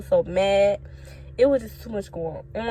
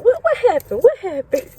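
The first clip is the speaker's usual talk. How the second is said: high, urgent worried questions; alarmed mom; 10 semitones higher, 6 dB louder than usual. Retold or imitated. Mom on the phone worried about her daughter, alarmed. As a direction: rush it like a mom hearing her kid's in trouble. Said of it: "she gonna be like".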